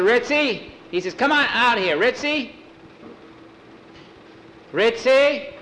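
high, loud repeated call; calling the dog. Acted. Boy calls his dog Ritzy to come out, loud high call.

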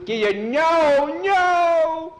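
very high, loud wail of no; terrified yell. Acted. Skeeter held above the spider pit, terrified. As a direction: scream it out in panic.

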